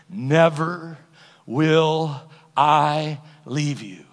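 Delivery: very slow, word by word; slow solemn promise. Shown as a demonstration. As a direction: draw out every word of the promise. Quotes God's promise word by word.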